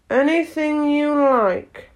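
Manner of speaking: slow indifferent. A narrator's reading. Footman dismissive.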